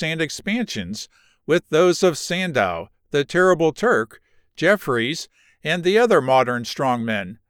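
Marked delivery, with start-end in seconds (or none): none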